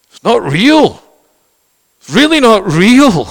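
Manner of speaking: high, loud stress on real; emphatic insistence. Acted. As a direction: hammer home that it's not real. Preacher's emphatic realisation.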